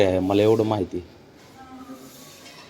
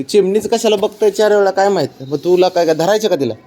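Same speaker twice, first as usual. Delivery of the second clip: higher, playful teasing of the dog; teasing pet talk; 6 semitones higher than usual. Real, not acted. Owner teasing dog staring at a sparrow, higher playful pet talk. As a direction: tease him about the sparrow.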